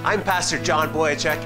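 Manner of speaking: normal talking voice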